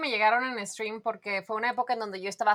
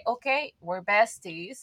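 slow mock declaration; 2 semitones lower, much slower than usual. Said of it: "es de que".

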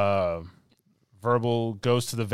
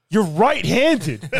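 loud, high, drawn-out holler; big hammed-up yell; mock exclamation; 10 semitones higher, 9 dB louder, much slower than usual. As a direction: shout it slowly in comic exasperation. Mock exclamation at movie's logic.

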